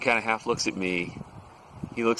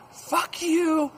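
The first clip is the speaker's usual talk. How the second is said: high-pitched defiant holler; defiant yell; 3 dB louder than usual. Retold or imitated. Quitting telemarketer to his supervisor, defiant imitated yell. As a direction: hurl it out high and hard. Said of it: "he points down at the supervisor".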